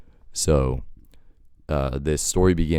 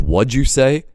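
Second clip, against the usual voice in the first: high, louder "what'd you say"; retold challenge; 9 semitones higher, 5 dB louder, slower than usual. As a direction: snap it back at him, rising. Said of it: "he goes".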